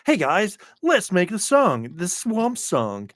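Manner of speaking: lightly voiced high mock [name]. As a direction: tease her voice, high and slowing. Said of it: "I don't want to hear her be like".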